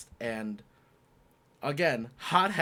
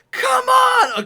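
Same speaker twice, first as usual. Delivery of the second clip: high, loud retold scream of triumph; screamed come on; 15 dB louder than usual. Opponent screaming across the court after a winner, loud high yell. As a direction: belt it out to the heavens. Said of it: "he just screamed at the top of his lungs".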